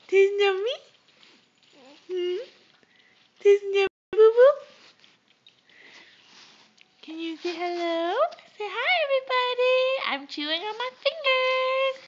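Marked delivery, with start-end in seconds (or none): 0.1-4.5 playful coaxing. Real, not acted. Coaxing infant, louder. 8.6-12.0 put-on high playful baby voice. Parent voicing baby's greeting.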